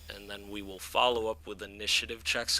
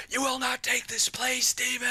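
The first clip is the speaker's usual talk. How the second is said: high, powerful, far-off commanding cry; warrior-like female voice; 14 semitones higher, 4 dB louder than usual. Acted. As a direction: call it out like a distant warrior spirit. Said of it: "you hear, uh".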